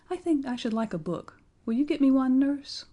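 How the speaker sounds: put-on high, polite little boy's request; young Lionel asking. A narrator's reading. Lionel asks Nurse for a book, high boyish voice. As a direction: lift the voice into a small boy's hopeful question.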